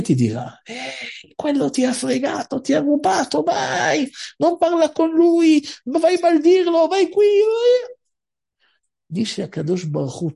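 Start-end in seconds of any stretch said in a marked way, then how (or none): 0.6-8.0 high, loud goading inner voice; evil inclination. Acted. The Yetzer Hara urges the cheated man to curse, goading. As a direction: egg him on to go curse the man.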